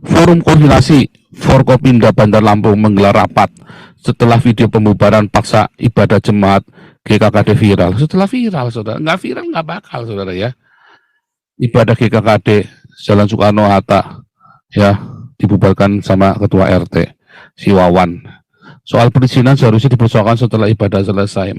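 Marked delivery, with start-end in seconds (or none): 0.0-8.0 low, level news-reading voice. Real, not acted. Preacher reading a church-closure report to followers, low news read. 11.6-21.6 low, level news-reading voice. Real, not acted. Preacher reading a church-closure report to followers, low news read.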